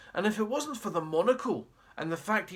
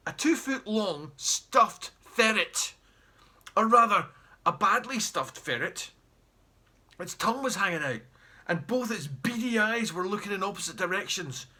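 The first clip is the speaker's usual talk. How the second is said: ordinary delivery, nothing marked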